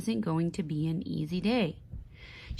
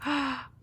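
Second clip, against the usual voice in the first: gasp; 6 semitones higher than usual. A narrator's reading. Reader gasps.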